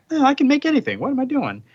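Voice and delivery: high-pitched acted self-reproach; sudden realisation. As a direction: act out catching yourself being silly.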